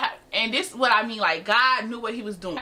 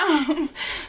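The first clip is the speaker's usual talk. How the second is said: little imitated awkward chuckle; awkward laugh; 5 semitones higher than usual. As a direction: give a small, forced, higher laugh. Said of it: "like the little awkward laugh".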